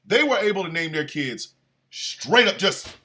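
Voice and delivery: normal talking voice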